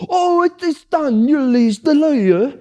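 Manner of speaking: very high, slow imitation of an eager friend; drawn-out high squeal of relief; high, slow mock announcement; mock relieved friend. Friend Johan voiced, relieved.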